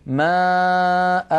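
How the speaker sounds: ماء held very slowly, the final a long; very slow, held "maa'aa"; pausal madd. Shown as a demonstration. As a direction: stretch out ماء, both long vowels drawn. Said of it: "أتكلم أنا على المد الثاني".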